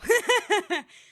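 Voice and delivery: sudden loud high laugh; a big real laugh; burst of laughter. Real, not acted. Laughing at own joke about pay.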